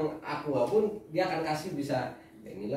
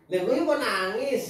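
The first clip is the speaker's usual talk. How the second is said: teasing; 9 semitones higher, 4 dB louder, slower than usual. Real, not acted. Mock crying question.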